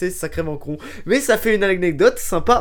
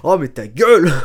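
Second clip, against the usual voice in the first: exasperated retort; 7 dB louder than usual. Acted. Acted exasperated retort in the dialogue.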